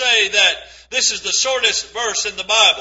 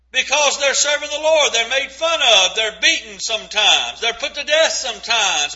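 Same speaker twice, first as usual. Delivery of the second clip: usual speaking voice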